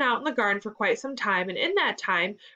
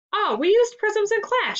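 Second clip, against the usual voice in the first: high, louder excited outburst; excited shout; 12 semitones higher, 5 dB louder than usual. A narrator's reading. Ethan remembering prisms in class, shouting excitedly. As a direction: burst in high and loud. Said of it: "shouted Ethan".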